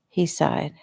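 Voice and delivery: ordinary delivery, nothing marked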